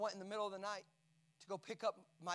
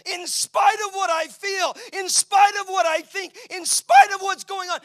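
full-force preaching; 11 semitones higher, 21 dB louder than usual. Acted.

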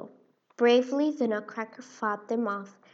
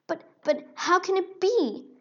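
startled mouse; 8 semitones higher, 4 dB louder than usual. Acted.

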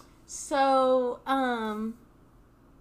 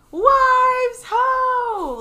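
loud high hail; calling out; 13 semitones higher, 13 dB louder than usual. Retold or imitated. Elder penguins hailing the returning wives loudly. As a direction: bellow it like a lookout. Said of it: "they're like".

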